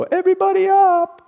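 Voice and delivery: high, loud wake-up call; playful high summons to wake everybody; calling everyone up. Acted. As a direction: rouse a sleeping crowd. Jesus calling the dead awake.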